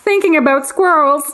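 high, airy, faraway musing; dreamy squirrel obsessive. Retold or imitated. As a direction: drift off thinking about squirrels. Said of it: "answering in dreamy voice".

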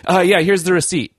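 imitating guilty campers bluffing the ranger; shifty campers. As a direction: act innocent while hiding something. Guilty campers handing fake receipt.

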